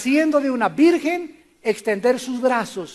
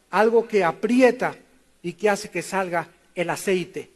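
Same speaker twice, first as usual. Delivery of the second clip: normal talking voice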